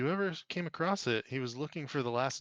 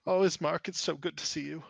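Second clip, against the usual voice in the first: joyful reunion; 5 semitones higher, 4 dB louder than usual. Acted. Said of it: "she embraces her brother and she goes".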